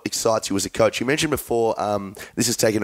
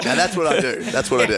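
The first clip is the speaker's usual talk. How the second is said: laughing, higher-pitched comeback; amused banter; 6 semitones higher than usual. Real, not acted. Host jokes along about boxing kangaroos, laughing. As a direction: play along through laughter.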